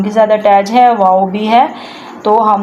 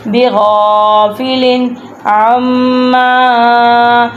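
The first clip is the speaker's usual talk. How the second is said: slow, measured tajweed recitation with repeats; Quran recitation; 2 semitones higher, slower than usual. Shown as a demonstration. Teacher recites verse for students to follow, measured chant. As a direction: chant each phrase, then repeat it.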